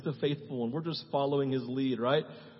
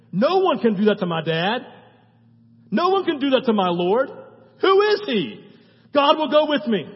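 higher, louder defiant cry; defiant shout; 8 semitones higher, 11 dB louder than usual. Retold or imitated. Pastor voicing David before Goliath, defiant shout.

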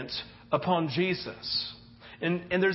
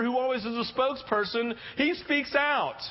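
ordinary delivery

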